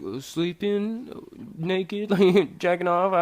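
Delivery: high, slow questioning answer; clueless stalling. Retold or imitated. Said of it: "I didn't know what to say".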